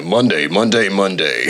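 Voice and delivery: deep booming hype announcer; fighting game announcer. Pretend. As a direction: boom it like an arena MC. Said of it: "very good announcer voice".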